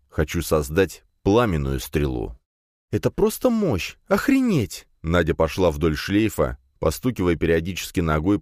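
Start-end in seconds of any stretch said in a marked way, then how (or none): 2.9-4.9 put-on high, awed girl's voice; amazed. A narrator's reading. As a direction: gasp out the wonder. Nadya amazed at destroyed target. (восхищённо)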